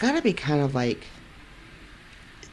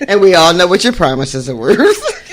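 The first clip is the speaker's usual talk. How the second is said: laughing; 5 semitones higher, 11 dB louder than usual. Real, not acted. Teasing friend about promises.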